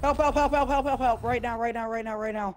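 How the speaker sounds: high, rapid repeated pleading; frantic pleading. Real, not acted. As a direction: beg for help in a panic. Downed player begging teammates.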